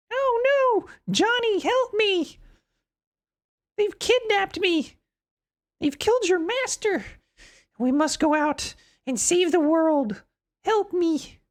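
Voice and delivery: high, mock girlish plea for help; damsel in distress. Reads the kidnapped girl's lines.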